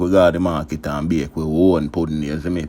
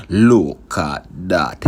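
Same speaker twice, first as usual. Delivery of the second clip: drawn-out, louder proud catchphrase; slow catchphrase; 5 dB louder, much slower than usual. Acted. Cook showing viewers the pudding, slow proud catchphrase. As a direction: stretch every word slowly, showing off the pudding.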